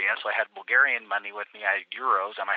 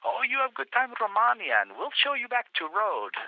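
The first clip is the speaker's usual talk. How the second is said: much higher mock policeman farewell; friendly policeman; 11 semitones higher than usual. Policemen wishing the tourist well, much higher voice. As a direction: send the tourist off cheerfully.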